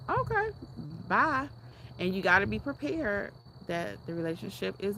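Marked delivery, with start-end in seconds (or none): 0.1-1.5 mock breezy bye. Mock dismissive farewell.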